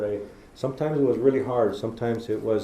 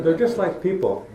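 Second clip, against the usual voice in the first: high, laughing through the words; 8 semitones higher, 6 dB louder than usual. Real, not acted. Teasing Curtis about his looks, laughing high. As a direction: crack up while teasing. Still laughing after joke.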